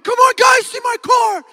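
high, loud, giddy call; excited shout. Retold or imitated. Teen calling classmates out to his car, excited shout. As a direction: yell for everyone to come and look. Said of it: "am so excited".

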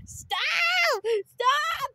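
high shouted stop. Acted. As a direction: scream at them to stop.

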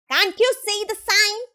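high, exasperated question; indignant scolding. A narrator's reading. Rabbit stops Pooh and Piglet, scolding indignantly.

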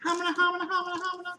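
high-pitched cartoonish babble; playful mock-flustered stammer, very high; comic stammer. Comic stammer after the reveal.

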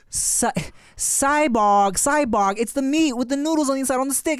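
put-on high-pitched, exasperated child insisting; frustrated kid. Kid insisting to mom about a dish, frustrated. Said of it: "we were both getting so frustrated".

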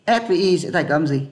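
ordinary talk

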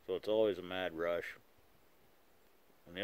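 talking around pipe stem; clenched pipe muffled speech; pipe in mouth. Real, not acted. Seen 4: Pipe stem held in mouth while speaking, removed by 3.3s.

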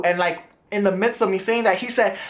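normal talking voice

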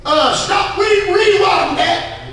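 high, urgent preacher's shout; calling a halt. Real, not acted. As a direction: shout to stop and rewind. Preacher halts to replay the verse.

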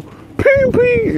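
very high loud ping ping; imitated ping. Gamer friends mocking him, high ping noise. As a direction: mimic the game hit sound. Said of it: "they know".

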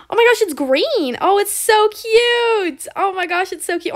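high, drawn-out squeal of delight; delighted gushing. Real, not acted. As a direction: gush over it, stretching the words. Delighted reveal of neon pet.

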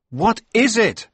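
high, loud startled question; alarmed cry. A narrator's reading. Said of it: "I cried".

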